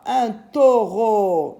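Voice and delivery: put-on teacher hamming up the bull for children; playful dramatic reveal. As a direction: make the bull sound impressive.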